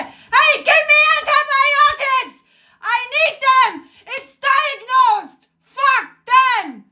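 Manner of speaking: high, shouted demand at a doctor; desperate angry demand. Acted. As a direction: yell it out as if confronting the doctor.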